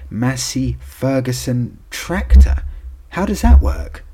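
loud, high disbelief; rising outrage, slowing on the tractor; incredulous. Real, not acted. Tractor result, repeated louder.

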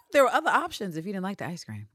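normal talking voice

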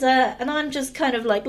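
normal talking voice

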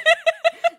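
a sudden high cackle; high burst of laughter. Real, not acted. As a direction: laugh out at your own joke. Laughs at own gloat.

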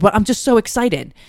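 high, loud, excited imitation; excited protest. Son's spirit answering back, excited. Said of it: "He's so excited".

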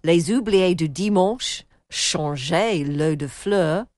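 slow, careful French pronunciation by an English speaker; apologetic French accent. Real, not acted. Presenter reading French novel titles from an email, careful French accent. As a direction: sound out the French titles slowly in an amateur accent. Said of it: "Forgive the accent".

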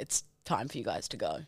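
retold closing notice. Retold staff closing the venue.

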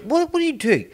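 high, startled challenge; baffled stranger snapping back; incredulous demand. Retold or imitated. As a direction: demand it like a stranger caught off guard. Confused guy confronting in supermarket.

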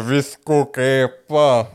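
slow, stretched mock drunk answer; drawn-out drunk reply. Drunk man accepts ride from kids, slow drawl. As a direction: drag the words out tipsily.

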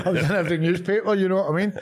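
laughing through the words, pitch up. Real, not acted. To the interviewer about being in every newspaper, laughing. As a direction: chuckle as you remember it.

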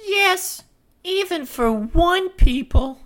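very high put-on voice; falsetto-like stage character; Marie's character voice. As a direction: read Marie in a piping, high-pitched voice. Reading Marie's part in script.

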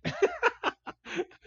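a sudden laugh jumping way up high; high burst of laughter. Real, not acted. Cracking up at the coach's text in a high laugh. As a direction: let a real laugh break out high.

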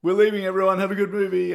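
loud, high mock announcement. Loud cinemagoer announces the group's exit, very loud. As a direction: call it out to the whole room. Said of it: "very loud".

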